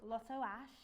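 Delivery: put-on a name said in a light Brummie accent that drifts toward Scouse; a mock Brummie accent that comes out a bit Liverpool. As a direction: drop into a Birmingham accent on the name. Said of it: "that sounds a bit Liverpuddian".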